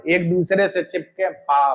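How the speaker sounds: ordinary delivery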